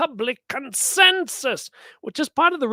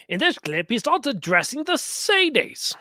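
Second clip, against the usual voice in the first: badly faked British accent; wobbly mock-British commentary voice; wandering put-on accent; 2 semitones higher than usual. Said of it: "no idea what this accent is doing".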